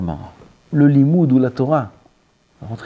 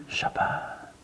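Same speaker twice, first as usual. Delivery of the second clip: whispered; 15 dB quieter than usual. Shown as a demonstration.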